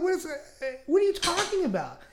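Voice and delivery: baffled. Retold or imitated. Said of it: "I'm like".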